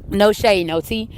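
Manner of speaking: ordinary delivery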